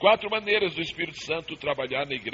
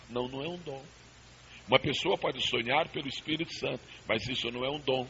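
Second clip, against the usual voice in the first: ordinary talk, nothing marked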